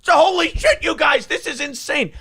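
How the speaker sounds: hammed-up freak-out of a shocked official; mock panicked shout. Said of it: "and they go".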